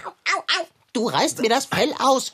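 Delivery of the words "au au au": schmerzhaftes Aua. Acted. Fell wird ausgerissen.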